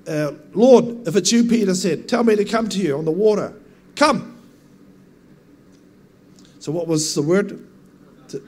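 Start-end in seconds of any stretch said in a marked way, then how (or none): 0.5-3.5 calling out as Peter. Acted. Voicing Peter calling to Jesus. 3.9-4.4 a high, ringing "Come." called across the water; Jesus calling "Come." high and loud over the water; commanding call. Acted. Preacher as Jesus calling Peter onto the water, a high ringing call.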